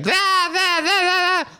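high falsetto whimpering da da; hammed-up high crying squeak; mock crying girl. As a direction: squeal it like a sobbing kid.

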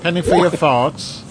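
put-on light old British lady voice; singsong British granny, lightly done; British accent. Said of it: "Say it, Madge".

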